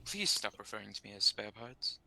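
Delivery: ordinary delivery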